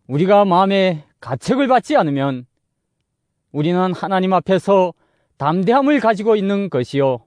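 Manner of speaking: ordinary talk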